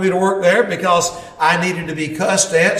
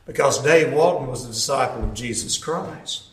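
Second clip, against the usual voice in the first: ordinary talk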